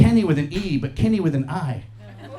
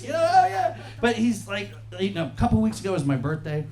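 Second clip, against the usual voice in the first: ordinary talk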